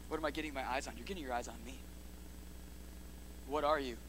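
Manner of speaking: ordinary talk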